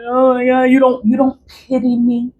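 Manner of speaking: high, whiny imitation of fake crying; mock crying. Woman mimicking the man who begged her for pity, mock crying. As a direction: put on a pleading, tearful whine, mocking. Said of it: "then he'll start crying".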